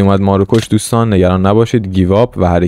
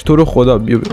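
usual speaking voice

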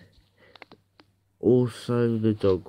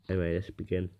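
normal talking voice

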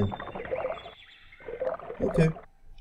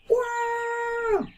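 high squeal; 3 dB quieter than usual. Real, not acted. Squeal at giant shadow.

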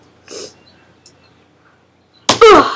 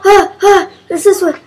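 ordinary talk, nothing marked

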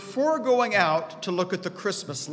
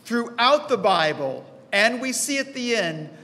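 ordinary talk

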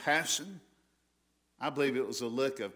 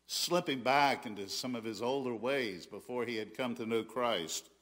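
ordinary talk, nothing marked

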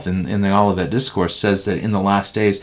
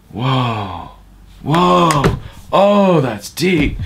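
higher, slow, hammed-up amazement; mock awed listener; 6 semitones higher, 3 dB louder, much slower than usual. Mocking dazzled congregants hearing nonsense, hammed-up high awe. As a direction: play a dazzled churchgoer, drawn out.

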